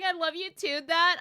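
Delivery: imitating the voice actor. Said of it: "The voice actor's just going".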